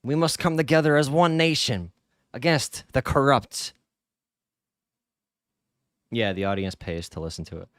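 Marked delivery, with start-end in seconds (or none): 0.0-3.7 louder, higher, booming rally oration; mock dictator speech. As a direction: ham up a fascist balcony speech. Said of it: "do the Mussolini speech".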